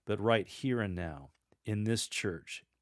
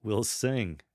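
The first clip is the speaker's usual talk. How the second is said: joyful, with a little chuckle in it; slight chuckle; 3 dB louder than usual. Shown as a demonstration. Lector overjoyed at the proclamation, lightly chuckling. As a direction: let a small chuckle into the words. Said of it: "add a little bit of a chuckle when you say the words".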